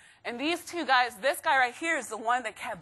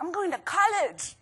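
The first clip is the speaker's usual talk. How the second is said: put-on higher, slow little-kid voice; second graders announcing it, higher and slow like a child; proud little kid; 7 semitones higher, slower than usual. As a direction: sound like a proud seven-year-old. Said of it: "They were like".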